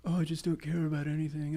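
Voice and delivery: soft, mock apathetic drone. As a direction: imitate someone who cares about nothing. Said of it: "[name] being like".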